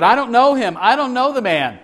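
high-pitched, hammed-up Galilean denial; mock Galilean accent. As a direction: deny it high, in a lightly imitated Galilean accent. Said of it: "in his best Galilean accent".

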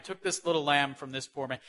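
usual speaking voice

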